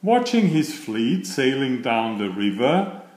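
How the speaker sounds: ordinary delivery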